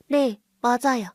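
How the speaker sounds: put-on small child. Child confirms the photo.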